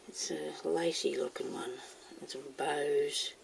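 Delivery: unvoiced whisper under her breath; whisper to self. Real, not acted. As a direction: murmur it under your breath. Whispering to herself while pouring.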